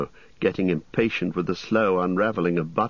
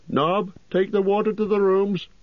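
higher, bustling landlord voice; fussy innkeeper. Acted. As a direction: play the chatty innkeeper, pitched up.

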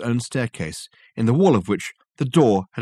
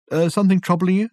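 higher, startled question; Reg, taken aback; 9 semitones higher, 4 dB louder, faster than usual. Acted. Reg taken aback as Richard stares at him. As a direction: caught off guard, ask kindly.

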